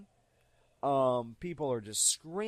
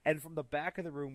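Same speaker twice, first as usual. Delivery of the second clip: ordinary talk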